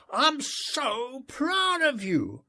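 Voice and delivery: high, slow call of pride; father calling proudly to his son across the river; roaring across the distance. A narrator's reading. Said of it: "I roared across the distance".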